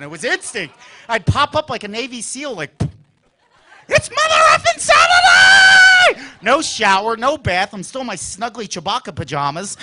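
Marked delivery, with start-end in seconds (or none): 3.9-6.3 huge high-pitched scream, drawn out; excited yell. Retold or imitated. Kid waking up on Saturday morning, excited yell. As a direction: bellow it with all-out kid excitement.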